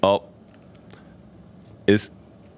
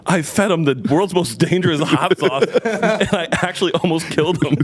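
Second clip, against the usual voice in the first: laughing while quoting; 7 semitones higher than usual. Retold or imitated. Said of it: "And I'm like".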